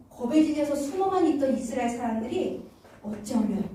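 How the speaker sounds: ordinary talk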